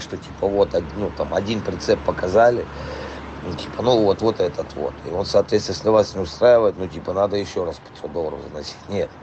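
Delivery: ordinary talk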